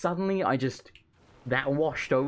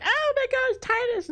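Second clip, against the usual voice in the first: way up high, yelled like a sports announcer; hyped commentator; 4 dB louder than usual. Retold or imitated. Streamer mimicking a game commentator's hype. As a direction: imitate an excited commentator spotting a star. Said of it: "and they're like".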